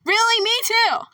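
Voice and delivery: high mock-excited squeal; sarcastic high surprise. Sarcastic reply to own question.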